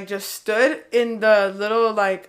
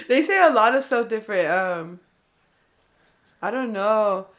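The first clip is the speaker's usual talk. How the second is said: normal talking voice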